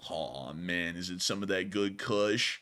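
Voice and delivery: low, slow stoner drawl. Pretend. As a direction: ask it lazily, half baked. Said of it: "that stoner voice you like to do".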